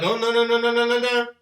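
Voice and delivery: loud repeated no, no, no; loud panicked refusal; loud protest. Retold or imitated. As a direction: yell no over and over at the screen. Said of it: "I'm like".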